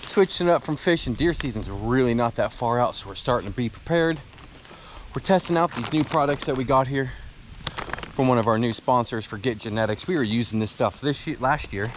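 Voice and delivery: breathless after a hill climb; talking while catching breath. Real, not acted. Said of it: "I'm sorry, I'm out of breath".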